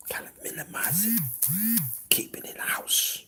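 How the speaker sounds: hushed, barely audible whisper. Real, not acted. Host advising the live audience on arguments at home, whispered. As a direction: whisper the advice, very softly. Hushed advice between loud points.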